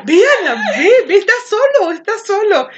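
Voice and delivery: high, loud coaxing "ve, Ana, ve"; eager urging. Retold or imitated. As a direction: egg her on like giggling friends. Amigas empujando a Ana. (amigas animando a Ana, agudo y emocionado)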